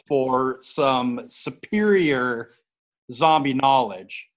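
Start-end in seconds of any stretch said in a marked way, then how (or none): none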